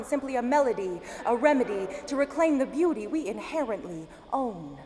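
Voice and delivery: impassioned, rhythmic slam poetry delivery; spoken-word poem. Acted. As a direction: recite it as a driving poem, rising in force.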